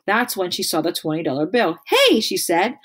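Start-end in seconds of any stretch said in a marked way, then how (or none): 1.9-2.2 high, loud startled call; sharp surprised hey. Acted. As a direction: catch her in the act. Nancy spots the money.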